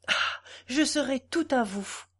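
touched, with a catch of feeling; moved to tears. A narrator's reading. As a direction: open on a sighed "Ah", moved. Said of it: "Des larmes vinrent aux yeux de Godefroy".

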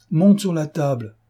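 a rowdy crowd demanding a sermon; shouted demand. Acted. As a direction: call it out over the table noise. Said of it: "cria-t-on de toutes parts".

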